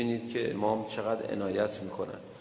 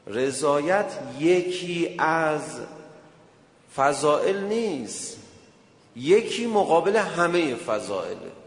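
louder, slow sermon delivery; slow emphatic preaching; 4 semitones higher, 5 dB louder, slower than usual. Acted. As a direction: drive the question home from the pulpit. (موعظهٔ کند و پرتأکید)

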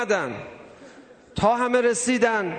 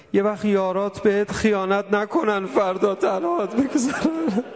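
tearful plea. Acted. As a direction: voice breaking into sobs. Zeinab's plea, preacher breaks into sobs. (التماس با گریه و بغض ترکیده)

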